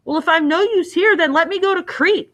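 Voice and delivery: high, eager plea; young hero's impassioned cry. A narrator's reading. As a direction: beg to be sent, pitch well up. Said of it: "cried Theseus".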